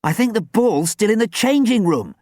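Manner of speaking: high, loud exclamation; urgent cry. A narrator's reading. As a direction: cry it out in alarm. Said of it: "Dan cried".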